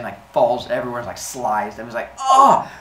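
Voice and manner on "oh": high, loud "oh"; hammed-up yell of disgust; disgusted cry. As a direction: cry out in mock revulsion. Zeus drops dissolving mess.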